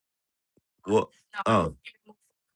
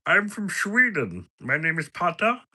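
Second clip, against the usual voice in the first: mock Swedish guy; 10 semitones higher than usual. Streamer pretending to be Patter from Sweden, high and goofy. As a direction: play a goofy Swede introducing himself. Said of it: "You're Russian, I know, Nick".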